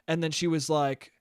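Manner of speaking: ordinary talk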